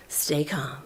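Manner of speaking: whispered self-calming; whispered reminder to keep cool; whisper to herself. Retold or imitated. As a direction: breathe the words to yourself, unvoiced. Telling herself to stay calm.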